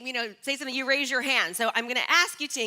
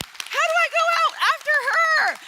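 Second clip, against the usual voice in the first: much higher, louder mock dismay; 8 dB louder than usual. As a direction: squeal it in playful disbelief. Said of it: "I'm like".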